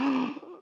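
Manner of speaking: sharp gasp of shock; mock horrified gasp. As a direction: suck in air in horror.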